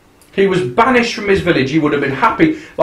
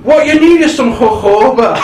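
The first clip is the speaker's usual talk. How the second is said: high, louder put-on voice, laughing; mock comic voice; 9 semitones higher, 5 dB louder than usual. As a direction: chime in with a silly character voice. Joins the comic impression.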